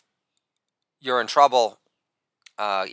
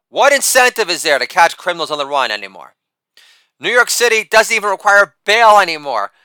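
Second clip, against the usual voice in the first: ordinary delivery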